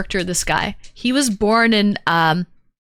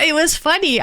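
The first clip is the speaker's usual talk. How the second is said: ordinary delivery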